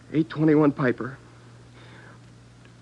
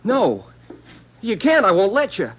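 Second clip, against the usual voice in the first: panicked protest; 5 semitones higher, 3 dB louder than usual. Acted. Suspect panics over photo.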